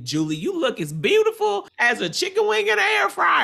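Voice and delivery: put-on loud, high-pitched country accent; over-the-top country contestant impression; imitated country accent. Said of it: "her in the D R saying".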